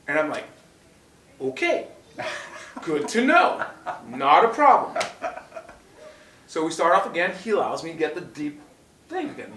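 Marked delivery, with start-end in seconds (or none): 1.4-5.0 sarcastic laugh. Retold or imitated. Sarcastic reply to referee ruling.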